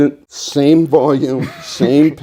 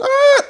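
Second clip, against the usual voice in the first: high scream cut off. Retold or imitated. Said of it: "And Mom stops".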